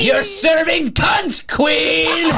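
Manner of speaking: high, slow drag queen shout; sassy drag queen. Real, not acted. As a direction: hype her up like a queen. Hyping the throw drag style.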